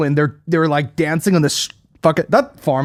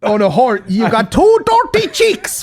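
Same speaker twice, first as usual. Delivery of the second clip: hammed-up stage Irish, climbing high; high, drawn-out Irish caricature; mock Irish accent; 6 semitones higher, slower than usual. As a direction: parody a bad film Irish accent, stretching words. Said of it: "the actual rundown on the accent".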